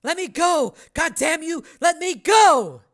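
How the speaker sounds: high, loud, furious protest; angry yelling. Acted. Tackled woman struggles to reach girl, angry yelling. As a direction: yell while fighting to break free.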